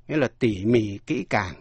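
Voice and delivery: normal talking voice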